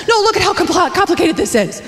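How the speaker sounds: high, pleading complaint; frantic whine. Retold or imitated. Wife showing husband charts, high pleading whine. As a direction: push the pitch up, pleading. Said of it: "And I'd show him schematics".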